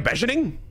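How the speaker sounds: "beginning" as beh-zhuh-ning, rising indignation; high, mangled BEH-zhuh-ning; mock alternative pronunciation. Shown as a demonstration. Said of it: "What am I supposed to say?".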